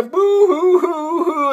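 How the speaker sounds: high, drawn-out fake boo-hoo; mock crying. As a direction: wail it like a cartoon crybaby. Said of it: "trying not to cry".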